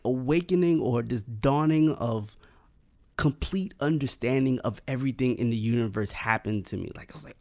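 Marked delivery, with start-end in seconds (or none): none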